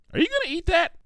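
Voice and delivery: high, fast, eager pony voice; bubbly Pinky. Acted. Pinky suggesting to Twilight, bubbly and quick. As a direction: blurt out a silly idea brightly.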